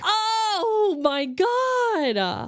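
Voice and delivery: high, crying exclamation of horror; shocked cry. Real, not acted. Horrified reaction to cat story.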